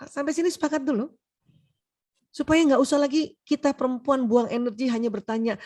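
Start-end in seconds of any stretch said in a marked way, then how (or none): none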